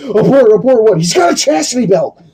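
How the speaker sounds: laughing through high-pitched mock alarm. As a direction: squeal it while cracking up. Repeating the joke while laughing.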